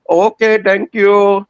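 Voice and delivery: high-pitched cashier asking again; fast food cashier. Retold or imitated. Cashier voice in retold scene.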